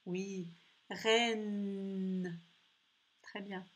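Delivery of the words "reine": "Reine" with a very long humming n; "Reine" trailing into a sustained nnn; stressed N sound. Shown as a demonstration. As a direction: hold the final n of "Reine". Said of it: "tu entends le son N".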